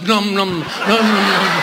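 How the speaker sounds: slow, drawn-out comic "numb"; mock muffled numming. Joke gynecologist pretending to numb the patient, muffled numming. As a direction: mumble the word like a buzzing noise.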